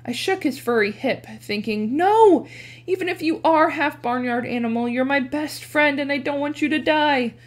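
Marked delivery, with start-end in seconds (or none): 2.0-7.4 desperate plea. Acted. Pleading with his dying friend.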